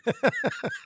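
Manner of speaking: a short, high burst of laughter. Real, not acted. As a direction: laugh at your own answer. Laughs at his own punchline.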